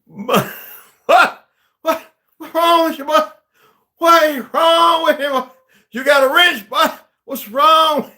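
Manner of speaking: very high, shouted disbelief; hammed-up screeching outburst; outraged movie character. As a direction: yell it way up high, imitating the character. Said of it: "the other brother character who said".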